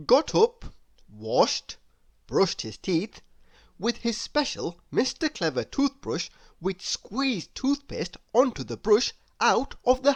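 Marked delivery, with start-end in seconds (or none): none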